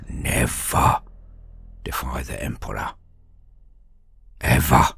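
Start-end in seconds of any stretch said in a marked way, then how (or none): none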